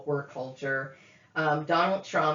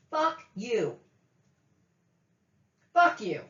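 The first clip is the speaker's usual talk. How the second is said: raised-pitch angry curse; real anger; 4 semitones higher, slower than usual. Real, not acted. Woman cursing relatives who take her money, angry. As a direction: spit out a curse with falling finality. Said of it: "I am so angry right now".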